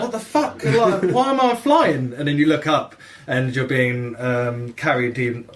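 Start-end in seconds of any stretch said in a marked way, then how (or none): 0.0-2.1 shocked confusion. Retold or imitated. Recounting a game mishap to friends, startled high-pitched disbelief. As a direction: play it like you've just been yanked into the sky. Retelling being snatched by bird.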